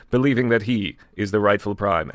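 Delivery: ordinary talk, nothing marked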